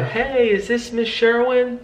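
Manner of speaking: slow, easy warmth on the phone; gentle demo. Shown as a demonstration. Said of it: "you wanna lower the guard there".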